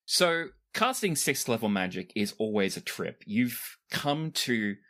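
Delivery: usual speaking voice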